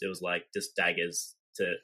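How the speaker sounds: ordinary delivery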